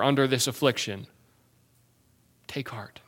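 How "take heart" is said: near-whispered, much softer comfort; soft take heart. Real, not acted. Pastor to suffering believers, softly hushed encouragement. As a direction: hush it gently to the hurting.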